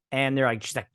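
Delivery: ordinary talk, nothing marked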